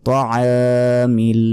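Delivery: তোয়ামিল slow, with ع pressed and the a held a second; careful tajweed তোয়ামিল; throat ayn, long a. Shown as a demonstration. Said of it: "আইনের উচ্চারণ করবেন".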